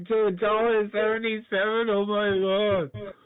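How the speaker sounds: amazed, voice jumping up; surprised. Real, not acted. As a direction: gasp it out in disbelief. Sees the price jump overnight.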